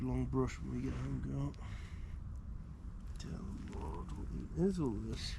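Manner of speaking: soft, hushed teasing of the dog; quiet cooing. Real, not acted. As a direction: murmur fondly to the dog.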